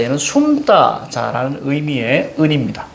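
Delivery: normal talking voice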